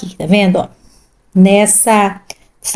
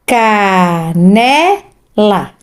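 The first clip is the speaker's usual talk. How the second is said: "Canela" stretched out syllable by syllable; very slow; much slower than usual. Shown as a demonstration. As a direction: sound out "Canela" for a child. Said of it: "sílabas". (bem devagar, sílaba por sílaba)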